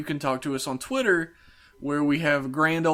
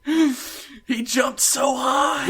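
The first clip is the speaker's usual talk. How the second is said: high, slow cheesy awe; mock amazed bystander; 11 semitones higher, 3 dB louder, much slower than usual. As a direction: gush like a B-movie extra. Mocking a fake jump scene.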